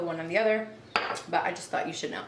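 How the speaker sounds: ordinary talk, nothing marked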